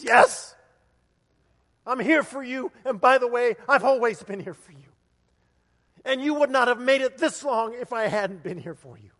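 booming promise, preached with conviction; emphatic reassurance. Acted. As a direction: declare it forcefully to someone desperate. Acts God's emphatic answer to plea.

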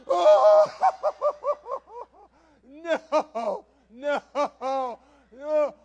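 loud, high mock-horrified wail; hammed-up panicked "oh no"; horrified realization. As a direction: cry out as if caught badmouthing someone. Realises the parent heard him.

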